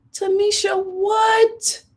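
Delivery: very high, incredulous squeal; high-voiced stunned gossip reaction; mock shock. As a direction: squeak it in playful disbelief. Mock shock at gossip just read.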